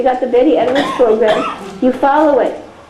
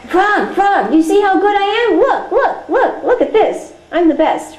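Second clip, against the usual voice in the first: higher, boastful show-off calling for attention; bragging Toad; 6 semitones higher than usual. Pretend. Toad shows off to Frog, higher boastful brag. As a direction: look at me, I'm the best, much higher.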